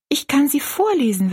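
put-on high, eager child's voice; high-pitched, willing offer; replying girl. A narrator's reading. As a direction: offer it in a high little girl's voice.

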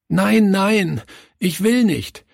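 put-on high, pleading child's protest; a boy sobbing in protest. A narrator's reading. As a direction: beg not to go, close to tears. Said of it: "schluchzte er".

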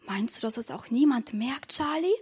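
soft, secretive asking; hushed question. Acted. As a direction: ask quietly, lifting at the end. Secret question after being hushed. (leise und heimlich gefragt)

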